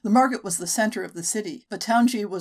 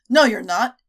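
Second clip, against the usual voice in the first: louder, menacing snarl; snarled threat; 4 semitones higher, 7 dB louder than usual. Acted. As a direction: growl the accusation at her. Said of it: "the first man snarled".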